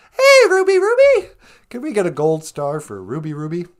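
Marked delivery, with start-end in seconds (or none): 0.1-1.3 loud cheer. Real, not acted. Cheering a chat viewer.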